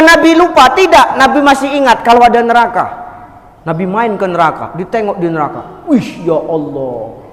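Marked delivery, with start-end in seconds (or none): 5.9-7.2 dismayed gasp. Acted. Prophet sees hell.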